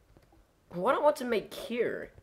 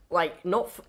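ordinary talk, nothing marked

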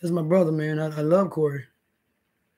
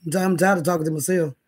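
usual speaking voice

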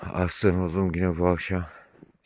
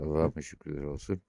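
usual speaking voice

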